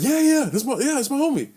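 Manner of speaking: high, urgent confirmation; retold flustered answer. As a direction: act out vouching for a friend to police.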